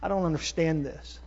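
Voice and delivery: soft, low admission; confiding prayer. Acted. Puzzled Bible reader opens up to God, quietly confiding. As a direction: confess it softly, dropping away. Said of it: "open up your heart to God".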